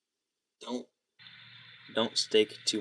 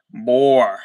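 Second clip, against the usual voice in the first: put-on shouted boy; 13 dB louder than usual. Quoting a game character, shouting Boy at full volume. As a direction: bellow the word Boy.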